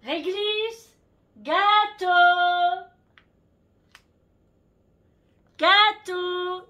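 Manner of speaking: high sing-song call to the dog; high, loud sing-song dog call. Real, not acted. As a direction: call the dog loudly from afar, drawn out. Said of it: "j'essaye de l'appeler".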